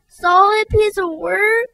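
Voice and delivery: high, slow hurt cry. Acted. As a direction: cry the words back, stung. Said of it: "she cried".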